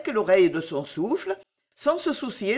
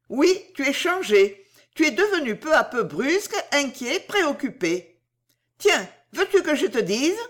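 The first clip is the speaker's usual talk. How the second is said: higher, animated voice, pressing him; Bijou insistante; 5 semitones higher than usual. Acted. (voix de Bijou, aiguë et insistante)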